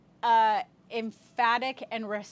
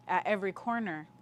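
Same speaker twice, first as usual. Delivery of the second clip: ordinary talk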